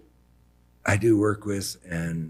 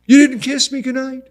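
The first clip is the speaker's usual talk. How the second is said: put-on childlike mother; 9 dB louder than usual.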